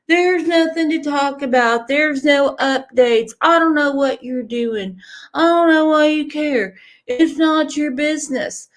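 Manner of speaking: mocking critics. Host quoting bullies who want her silent, mocking scolding. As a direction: parrot the naysayers with scorn. Mimics critics telling them to stop.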